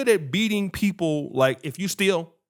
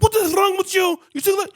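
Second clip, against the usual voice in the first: high, loud outraged yell; retold tirade, shouted at the top of the pitch range; angry shouting; 14 semitones higher, 5 dB louder than usual. Resident yelling at the drunk guard, high angry shout.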